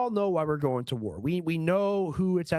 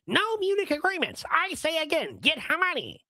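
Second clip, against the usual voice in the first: much higher, strained parody of Mark Levin; mock Mark Levin; 11 semitones higher than usual. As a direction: squeak it out like a pompous pundit. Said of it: "More from resident Humpty Dumpty, Mark Levin".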